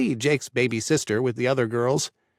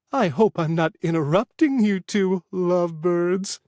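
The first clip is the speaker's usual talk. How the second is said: put-on high, sing-song old woman greeting; old lady voice; 6 semitones higher than usual. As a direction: croon it like a sweet old lady. Narrator voicing the old lady.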